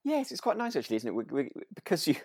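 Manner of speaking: ordinary talk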